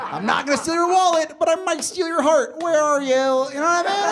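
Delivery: high, loud hammed-up charmer voice; mock flirty pickup. Comic brags to audience he'd steal hearts, mock flirty. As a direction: play the smooth romantic tough guy, tongue in cheek.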